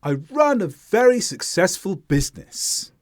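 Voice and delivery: confident statement stepping down from RUN to BUSINESS; falling intonation. Shown as a demonstration. Teacher showing learners confidence, falling to BUSINESS. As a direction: start high on RUN, let the tone fall through BUSINESS. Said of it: "this is where intonation is falling".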